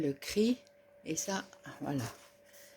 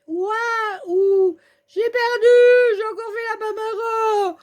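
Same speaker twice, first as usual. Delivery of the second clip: mock dismay; 11 semitones higher, 6 dB louder, much slower than usual. Losing the battle.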